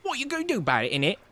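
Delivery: high, hammed-up British tough guy; mock London roadman. Streamer mocking the bullying mods as a British roadman. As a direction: play a cocky London thug.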